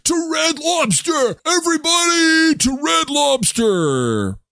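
high slow rally cry; rallying shout. Pretend. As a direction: call the whole crowd out. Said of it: "that's enough. Settle".

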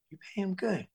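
high, soft incredulous question; amazed echo. Retold or imitated. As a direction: echo it back in hushed, high-pitched wonder. Amazed echo of the crew's answer.

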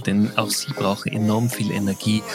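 ordinary talk

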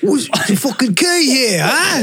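slow, high hammed-up Cockney; mock London accent. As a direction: put on a broad London accent. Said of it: "you would have done an accent".

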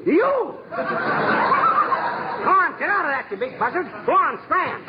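very high, worked-up hollering; shooing yell. Acted. Husband shooing an owl out of the tree, yelling. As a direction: holler to drive the bird off.

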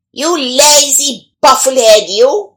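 put-on sharp shouted scolding at a girl; shouting. A narrator's reading.

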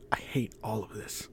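hushed, fearful whisper of despair; scared whisper. A narrator's reading. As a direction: whisper it like you're terrified to be heard. Frightened girl on the bus.

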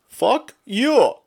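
put-on high, loud scammer with an Indian accent; mock-angry outburst in an Indian accent; imitated Indian accent. Scammer realizes he's being led on, angry Indian-accented retort. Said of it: "in his Indian accent or whatever".

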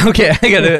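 ordinary talk, nothing marked

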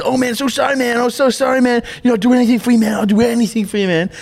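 high, pleading over-apologetic stranger; gushing apology. Retold or imitated. Driver who hit him, apologising in a high gushing voice. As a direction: beg forgiveness in a squeaky, gushing voice. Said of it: "And the guy goes".

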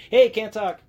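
high, quick can't-stop greeting; mock rushed wave-off; hurried brush-off. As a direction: toss it off while passing by.

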